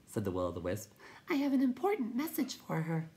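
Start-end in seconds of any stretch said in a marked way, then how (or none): 1.3-3.1 very high little voice; timid creature. A narrator's reading. Will-o'-the-Wisp explains its errand, high little voice. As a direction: pipe it in a tiny high voice.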